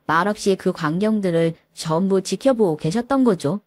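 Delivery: ordinary delivery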